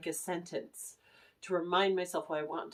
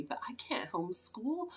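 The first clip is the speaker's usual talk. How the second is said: whispered aside; 3 semitones higher, 5 dB quieter than usual. Real, not acted. Worried aside about homeschooling.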